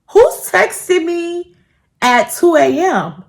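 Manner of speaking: baffled, drawn out; puzzled. Retold or imitated. Woken at night by a text, slow baffled question. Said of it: "I'm like".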